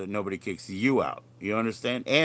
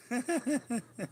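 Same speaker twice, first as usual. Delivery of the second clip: high laugh; 5 dB quieter than usual. Real, not acted. Laughs at own gummy bear joke.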